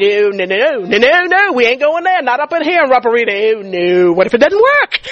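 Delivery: frantic sing-song refusal, pitch leaping up; panicky inner protest. Retold or imitated. Preacher replaying her own doubts to the crowd, mock-panicked. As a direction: balk in a rush of mock-panicked no's. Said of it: "that's what was going on in my head".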